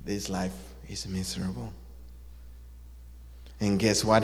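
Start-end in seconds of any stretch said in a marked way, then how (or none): 0.0-1.7 low, soft mock misery; glum. Battered rock in the river bemoans its life, glumly. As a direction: sigh it out low and soft, defeated. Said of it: "the rock said".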